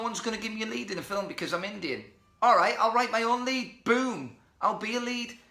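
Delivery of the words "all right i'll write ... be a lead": high, loud, triumphant declaration; defiant self-belief. Real, not acted. Actor answering that nobody will cast him, defiant. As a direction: punch it out like a winning answer.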